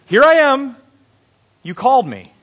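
high, loud eager answer; eager boy. A narrator's reading. Samuel runs in to Eli, eager boy. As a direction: pipe up like a boy reporting in.

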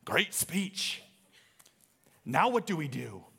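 put-on amateur Irish accent; attempted Irish accent. Stephen leans over to his buddy after the speech, attempted Irish accent. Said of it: "I'm gonna attempt my Irish accent".

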